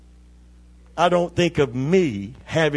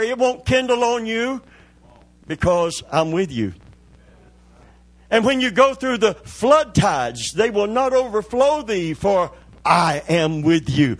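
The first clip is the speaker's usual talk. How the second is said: full-force preaching; 5 semitones higher than usual. Acted.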